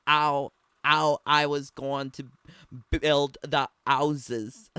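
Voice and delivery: loud imitation of Northern English, dropped H's; Northern English accent. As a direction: put on a Northern English accent, punching the words out. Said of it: "It's a Northerner English accent".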